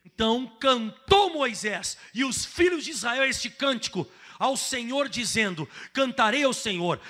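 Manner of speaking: loud, slow, solemn reading of the verses; scripture reading. Acted. Preacher reading Moses' song to the church, loud and solemn.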